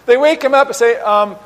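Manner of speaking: ordinary talk, nothing marked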